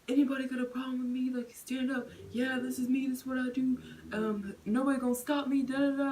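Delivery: retold bully daring the whole car; imitating loud ranting. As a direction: swagger down the aisle taunting everyone. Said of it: "he was yelling the whole time".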